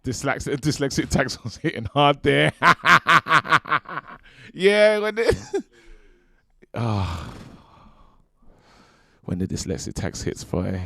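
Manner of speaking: loud, laughing self-mockery; cracking up. Real, not acted. Host joking about his own reading slip, laughing loudly. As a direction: burst out laughing through the words.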